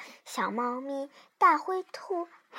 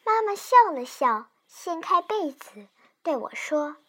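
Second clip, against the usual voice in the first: ordinary delivery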